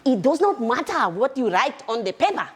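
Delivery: excitable, emphatic South African character voice; Veronica's South African accent. Shown as a demonstration. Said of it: "because this is how she talks".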